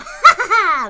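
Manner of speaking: high, loud gloating laugh; evil laugh. Acted. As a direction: cackle with glee. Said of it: "Grouch laughed".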